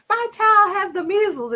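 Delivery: very high, loud mock coworker voice; coworker's sad story. Coworker telling a sad story at work, very high mock voice. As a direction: pitch it up like a coworker sharing bad news. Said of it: "somebody tells a story like".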